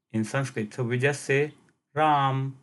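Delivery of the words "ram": "Ram" ending on a closed m; half last syllable. Shown as a demonstration. Teacher showing listeners Hindi pronunciation, "Ram" with half final syllable. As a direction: say "Ram" with no vowel after the m. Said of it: "last syllable is pronounced half".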